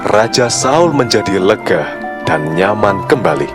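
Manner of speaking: usual speaking voice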